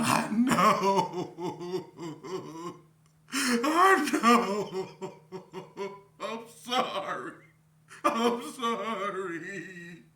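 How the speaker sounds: high, loud laugh through the words; cracking up while apologising; laughing apology. Real, not acted. Telling the guys on the phone sorry, laughing hard.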